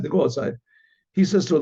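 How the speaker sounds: usual speaking voice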